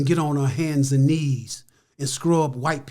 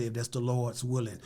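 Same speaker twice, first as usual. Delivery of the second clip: softer, choked-up hush; hint of a mother crying; retold tearful; 3 semitones lower, 8 dB quieter than usual. Mother crying over her son's promise, softly. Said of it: "she started crying and said".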